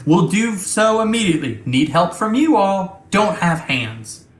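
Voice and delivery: a hint of eager machine compliance; Ava, robotic ship intelligence. Ava agrees to reprogram Tex, lightly robotic. Game master voices Ava.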